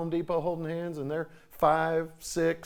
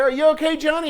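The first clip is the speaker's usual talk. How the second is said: much higher, louder worried yell; mother yelling to her son from the bleachers; mom yells; 11 semitones higher, 8 dB louder than usual. Retold or imitated. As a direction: call out from the stands, high and anxious. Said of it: "she yelled out there".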